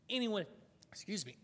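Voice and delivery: words cut off by a choke; choking on a bug. Real, not acted. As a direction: swallow a bug mid-word. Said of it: "I swallowed a bug".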